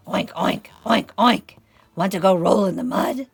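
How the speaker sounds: loud, oinking pig voice; oink, then quickly call out the invitation; boisterous picture-book pig; storybook pig. Acted. Pig's line in story.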